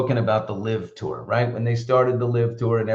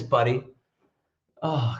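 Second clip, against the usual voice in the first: ordinary delivery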